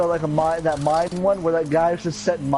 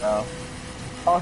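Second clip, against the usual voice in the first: ordinary talk, nothing marked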